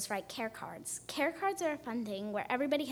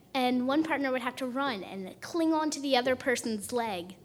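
usual speaking voice

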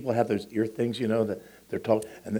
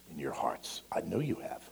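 hushed whisper; 5 semitones lower, 9 dB quieter than usual. Acted. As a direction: drop to a whisper. Preacher drops to whisper.

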